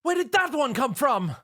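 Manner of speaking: high, startled question; alarmed cry. A narrator's reading. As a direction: cry out in fright at the new monster. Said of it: "Rarity cried out again".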